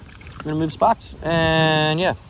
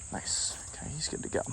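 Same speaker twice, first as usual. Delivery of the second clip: soft whisper while releasing; 3 semitones higher, 12 dB quieter than usual. Real, not acted. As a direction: hush it as you let go. Releasing the fish gently.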